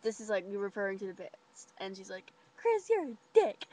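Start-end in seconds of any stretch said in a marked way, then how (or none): 2.6-3.7 higher, slow teasing jab; drawn-out playground taunt; mock insult. Hannah calling Chris a name, slow high mock taunt.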